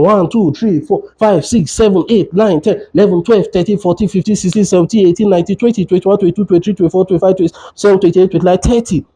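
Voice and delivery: steady rhythmic counting in pairs; even metronome count of thirty; compression count. Shown as a demonstration. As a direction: count out each chest push to a beat. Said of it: "keep giving your chest compression".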